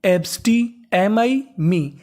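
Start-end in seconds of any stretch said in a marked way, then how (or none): none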